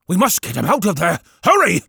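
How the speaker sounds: loud, urgent vicar; urgent shout. Acted. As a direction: push it out, alarmed.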